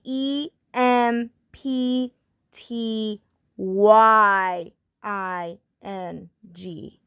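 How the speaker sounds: EMPTYING spelled out slowly, letter by letter; slow spelling. Shown as a demonstration. Teacher correcting her spelling slowly for students. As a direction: drag out each letter of the word. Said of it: "ignore what I said, and it's going to be".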